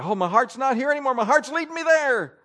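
loud, high, hammed-up gushing; mock someone carried away by their feelings, quickly; mock heartfelt pleading. Mocking someone led by feelings.

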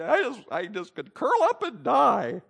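hammed-up, high-pitched wail of despair; mock emotional meltdown. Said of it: "when we have emotional meltdowns sometimes".

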